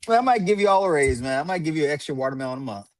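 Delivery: ordinary delivery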